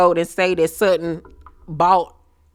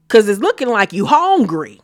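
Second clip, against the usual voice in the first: ordinary talk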